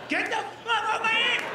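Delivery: high, frantic mock yell; panicked giant man. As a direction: imitate a big guy yelping in a fight. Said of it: "he was like".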